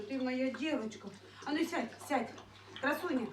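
ordinary talk

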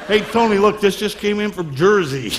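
New York beachgoer calling out; New York accent, mock surprised; New Yorker. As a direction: call to your buddy in a thick New York accent. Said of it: "people in New York".

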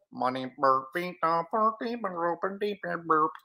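nonsense alien babble; burbled beeps and burps as alien speech; alien language. Acted. Storyteller reading the aliens' chatter, alien babble. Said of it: "that's alien language".

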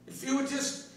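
usual speaking voice

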